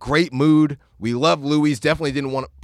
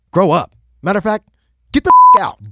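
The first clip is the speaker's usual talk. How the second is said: sudden shout that jumps much higher and louder; mock outburst; 6 semitones higher, 9 dB louder, faster than usual. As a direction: blow up at the customer, half in jest. Mock bartender kicking out.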